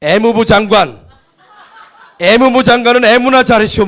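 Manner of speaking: booming Gyeongsang comeback; Gyeongsang accent. Retold or imitated. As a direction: fire back like the Gyeongsang president, laughing. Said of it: "김영삼 대통령이".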